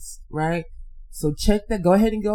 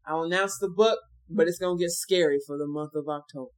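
ordinary talk

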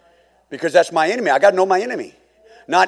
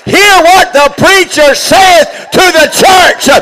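1 heart screaming; 12 dB louder, much slower than usual. Acted. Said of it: "your heart is screaming".

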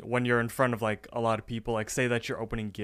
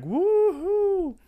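high, loud whoop into raised-pitch celebration; excited; 7 semitones higher, 5 dB louder, much slower than usual. Retold or imitated. Raffle winner claiming his prize, excited. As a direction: act out a jubilant raffle winner. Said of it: "Muscle Man is all excited".

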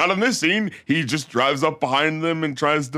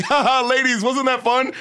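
high-pitched teasing taunt with a fake laugh; mock gloating villain; 7 semitones higher than usual. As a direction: gloat at the ladies, hammed-up. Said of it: "he's like".

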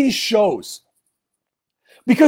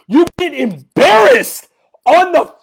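usual speaking voice

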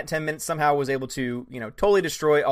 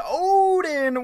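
high mock greeting; 13 semitones higher, 5 dB louder than usual. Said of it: "Tech Guy says".

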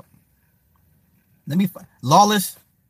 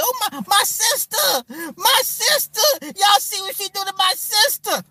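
shrill high mocking impression of the girl; mock her crying about her sister, way up high; hammed-up wailing falsetto; husky mocking woman voice. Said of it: "why your voice so husky".

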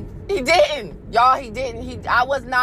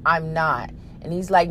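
normal talking voice

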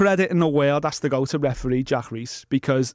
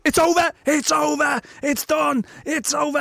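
high-pitched mock ringside call; urgent, repeated high exclamations; excited commentator; 10 semitones higher than usual. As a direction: imitate a commentator losing it. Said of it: "Every commentator, including Pete".

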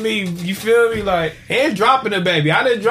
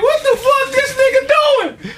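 very high, drawn-out comic shriek; hammed-up outraged baby, squealing the words; screaming baby voice; 4 dB louder than usual. Voices the screaming baby's thoughts.

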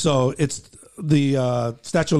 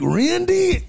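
disbelieving; 3 dB louder than usual. Retold or imitated. Caller repeating disbelieving.

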